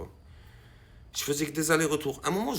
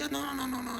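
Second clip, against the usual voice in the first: high-pitched acted refusal; high, insistent voiced refusal; high mock refusal; 13 semitones higher, faster than usual. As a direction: play him squeaky and stubborn.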